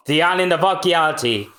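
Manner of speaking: ordinary talk, nothing marked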